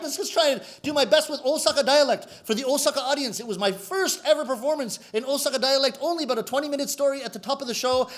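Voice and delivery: usual speaking voice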